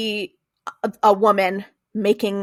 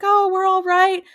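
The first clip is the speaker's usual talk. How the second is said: high-pitched imitation of a neighbor replying; mock folksy reply, lifted well up; neighborly small talk; 11 semitones higher than usual. Voicing the other neighbor's reply.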